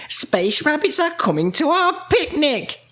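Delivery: high, excited announcement; astonished witch. A narrator's reading. Winnie sees rabbits coming to the picnic, high astonished voice. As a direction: exclaim it high with surprise.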